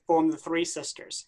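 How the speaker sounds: ordinary talk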